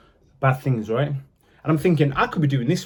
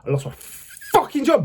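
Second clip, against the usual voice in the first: high, incredulous angry burst; angry outburst; 8 semitones higher than usual. Real, not acted. Ex-prisoner recalls being sacked, angry and incredulous. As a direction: relive the outrage, pitch shooting up. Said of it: "I'm still feeling angry about that".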